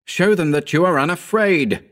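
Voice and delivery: high-pitched urgent cry; insistent cry of encouragement. A narrator's reading. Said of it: "I cried".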